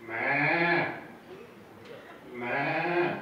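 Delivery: drawn-out goat "meh"; imitated goat bleating; goat bleats. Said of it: "they go".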